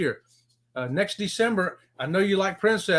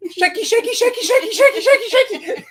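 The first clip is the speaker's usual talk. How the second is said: high, loud, laughing [name] shimmy chant; [name] impression; 13 semitones higher, 7 dB louder than usual. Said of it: "did you like my [name]".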